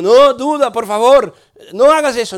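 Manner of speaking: ruego fuerte para frenar al amigo, recreado; súplica alarmada. Retold or imitated. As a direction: suplicar en voz alta, imitando la alarma. Suplica a Duda que pare. (súplica alarmada, recreada)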